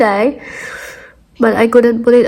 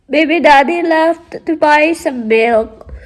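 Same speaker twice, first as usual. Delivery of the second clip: normal talking voice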